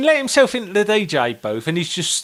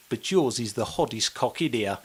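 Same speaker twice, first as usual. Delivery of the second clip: put-on low drunk flattery, half-laughing; 6 semitones lower, 8 dB quieter than usual. Imitating drunk Aussie, laughing.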